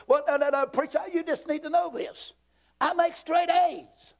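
high, self-important protest; mock boasting objector. Student to preacher bragging about grades, high mock boast. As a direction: boast it high, stretching the final words.

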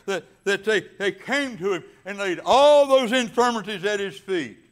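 loud, high, rolling preacher cadence; full-force preaching. Acted. Pastor preaching to his congregation, loud and fervent. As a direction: drive the scripture home to the congregation.

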